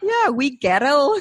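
slow, drawn-out attempt at a Scottish accent; mock Scottish accent. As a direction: try the wee Scottish phrase slowly. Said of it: "that means a little girl".

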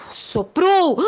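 big huffing breaths; wolf blowing. Acted. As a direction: huff and puff hard.

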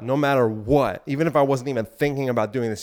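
normal talking voice

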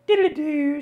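high sing-song doodly-doo; high doodly-doo hum. Shown as a demonstration. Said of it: "I go".

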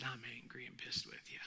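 hushed whisper of shared anger. Retold or imitated. Pastor telling his grieving friend he shares his anger, in a whisper. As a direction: breathe it out, barely voiced.

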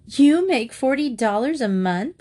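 mocking sister's incredulous question; sneering. A narrator's reading. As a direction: sneer it back in disbelief. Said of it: "sneered Sylvia".

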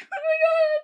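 squealing oh my god, near tears; high distressed cry. Real, not acted. Shocked by the death news, high cry. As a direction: let it crack up high.

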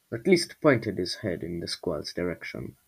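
plain reading voice

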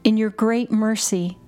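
normal talking voice

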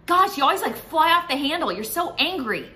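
high, loud exasperated accusation; accusing spouse. Retold or imitated. Wife accusing husband of constant anger, loud high exasperated voice. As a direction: throw it at him in frustration. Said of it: "No, they're not angry all the time".

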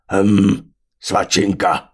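loud, pleased brutish voice; greedy brute. A narrator's reading. The glutton spots the leftover pig, loudly relishing it.

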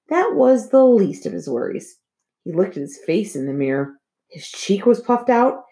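usual speaking voice

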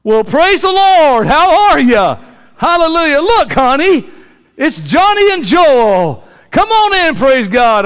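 high, loud, slow churchy greeting; mock pious host. Ron greets the pastors at the door, hammed-up pious welcome. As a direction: gush holy welcome to cover the fight.

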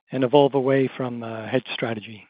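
ordinary talk, nothing marked

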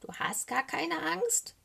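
high, slow, let-down question; disappointed. A narrator's reading. Little raven learns bear is fearless, disappointed. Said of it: "fragt Socke enttäuscht".

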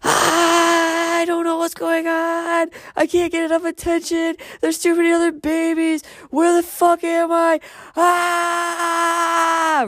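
hammed-up, high screaming baby panic; Jessica. Panicking baby's thoughts at daycare, mock hysterical screech. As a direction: wail every word on one high, sustained note. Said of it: "this is what Jessica's mind's thinking".

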